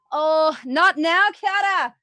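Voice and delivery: loud, high, slow groan of a scold; exasperated scold. Acted. Flannel girl, covered in paint, scolding Kiara loudly. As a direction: drag out the complaint at her.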